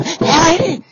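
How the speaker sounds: high, loud mock scream; sudden piercing yell in imitation; imitated scream. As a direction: shriek it out like someone making a scene. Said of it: "they were screaming, making noise campaign".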